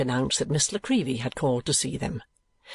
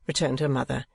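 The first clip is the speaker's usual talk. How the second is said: plain reading voice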